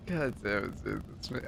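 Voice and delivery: ordinary talk, nothing marked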